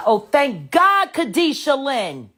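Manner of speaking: ordinary talk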